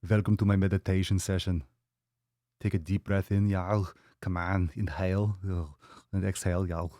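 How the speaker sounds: a hint of Austrian action star; lightly Austrian-accented meditation guide; Arnold Schwarzenegger. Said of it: "meditations with Arnold".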